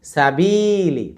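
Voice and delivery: slow সাবিলি with long held বি; quick সা, sustain বি, short লি; stretched middle. Shown as a demonstration. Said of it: "বিতে টান".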